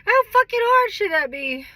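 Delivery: near-shouted exasperation; exasperated outburst. Real, not acted. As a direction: throw it out, fed up. Venting frustration about marriage.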